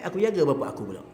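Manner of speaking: ordinary talk, nothing marked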